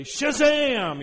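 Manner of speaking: big, high, loud showman's cry; explosive, hammed-up wonder-worker yell; mock magic shout. Mock magician-style raising of Lazarus.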